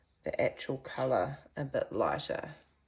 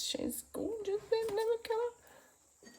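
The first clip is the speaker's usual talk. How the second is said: gushing admiration. Real, not acted. Admiring her yellow paint.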